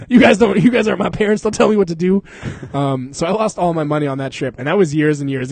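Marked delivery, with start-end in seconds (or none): 0.1-2.2 mock defiance. Mock defiance toward friends.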